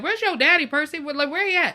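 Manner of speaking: high, loud, sassy questioning; exasperated demand. Retold or imitated. Host asks Percy where his father is, exasperated. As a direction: call out, demanding to know where he is.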